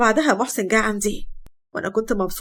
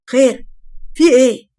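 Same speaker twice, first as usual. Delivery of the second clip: sharp, loud what's going on; loud demand; 2 semitones higher, 5 dB louder than usual. Acted. Son bursting out at the shouting, loudly demanding what's going on. Wael hears shouting, comes out.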